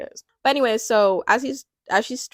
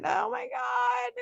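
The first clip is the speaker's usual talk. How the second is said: much higher, drawn-out, near-crying cry of joy; emotional squeal; 5 dB quieter, slower than usual. Real, not acted. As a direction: let it burst out like you're overwhelmed and about to cry. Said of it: "and it was beautiful".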